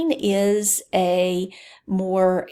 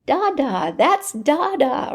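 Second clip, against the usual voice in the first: much higher, louder delighted cheer of "da, da"; higher, louder excited parent praising "da, da"; excited parent praise; 7 semitones higher, 4 dB louder than usual. Retold or imitated. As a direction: gush over the baby's first word. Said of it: "We say".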